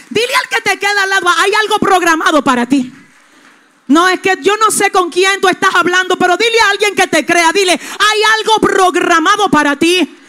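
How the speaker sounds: loud, urgent preacher's call; preaching call-out. Acted. Pastor urges the congregation to tell each other, loud. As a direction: tell your neighbour, loudly.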